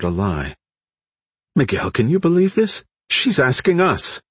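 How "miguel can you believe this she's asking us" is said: high-pitched, disbelieving appeal; incredulous. A narrator's reading. Woman asking Miguel if he can believe this, incredulous.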